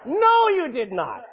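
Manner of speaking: slow firm denial. Real, not acted. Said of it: "repeated denial slower".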